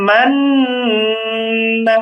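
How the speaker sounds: ম্যান with a long nasal n; ghunnah. Shown as a demonstration. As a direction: hold the n of ম্যান humming, then release না. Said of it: "তাহলে উচ্চারণ হবে".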